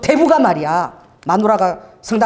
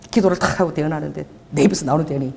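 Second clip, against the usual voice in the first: ordinary talk, nothing marked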